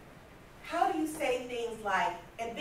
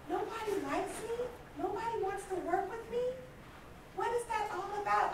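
wounded disbelief; 10 semitones higher, 5 dB quieter than usual. Retold or imitated. Hurt self-pity at coworkers' rejection.

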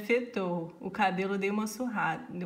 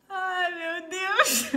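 slow, drawn-out relieved laugh; half-crying laugh; 8 semitones higher, 4 dB louder, much slower than usual. Real, not acted. Relieved laugh at friends' kind replies. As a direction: melt with relief.

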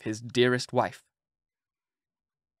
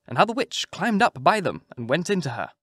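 usual speaking voice

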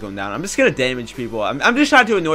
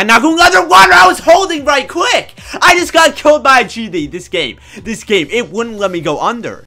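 high, loud gamer rage; raging; 7 semitones higher, 5 dB louder than usual. Real, not acted. Gamer killed because he couldn't dive, raging. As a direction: rant in outraged disbelief at the game. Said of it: "I'm the one raging".

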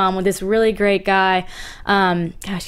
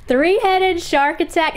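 Southern drawl; 7 semitones higher, slower than usual. Shown as a demonstration. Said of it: "bring out the drawl".

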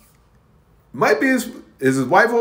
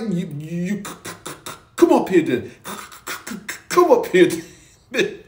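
stammering on the c, half-swallowed; stuttering. Retold or imitated. Pete stuttering back at Alan downstairs, upset stammer. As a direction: stutter out a nervous dare. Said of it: "Pete stuttered".

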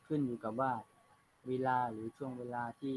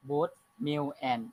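slow dictation reading; 5 dB louder, much slower than usual. Shown as a demonstration. Teacher reads text aloud.

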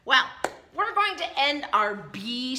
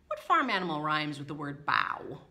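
ordinary delivery, nothing marked

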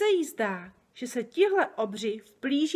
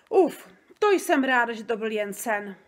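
relieved; 2 semitones higher, 8 dB louder than usual. Acted. Girl relieved after bad dream.

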